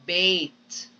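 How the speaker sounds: "bait" with a clear ay vowel; A sound demo; clear long A. Shown as a demonstration. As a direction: model "bait" slowly and clearly. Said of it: "Just like the word".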